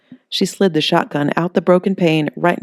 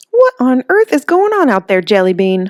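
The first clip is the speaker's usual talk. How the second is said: much higher, louder startled call; alarmed Holly; 9 semitones higher, 6 dB louder than usual. Acted. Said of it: "Holly said".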